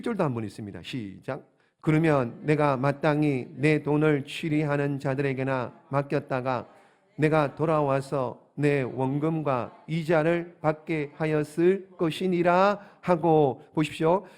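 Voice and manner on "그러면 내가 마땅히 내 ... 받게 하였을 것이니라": low, slow, measured scripture reading. Acted. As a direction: read the verse out solemnly in deliberate phrases. Reads the scripture aloud. (낮고 느린 성경 봉독)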